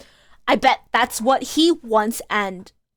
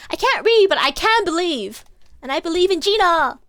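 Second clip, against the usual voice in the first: loud, raised, hammed-up rallying cry; mock heroic; 6 semitones higher, 5 dB louder than usual. Player jokingly rallies behind Gina, mock heroic. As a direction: declare it like a hero.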